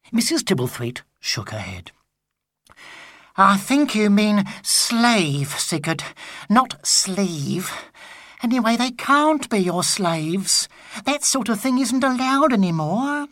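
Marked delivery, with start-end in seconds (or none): 3.4-13.3 lightly higher, slow correcting tone; patient wife. Acted. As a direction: gently set him straight. Wife voiced.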